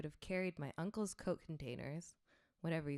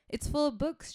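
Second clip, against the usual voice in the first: loud; 10 semitones higher, 8 dB louder than usual. Retold or imitated. Answering the movers back.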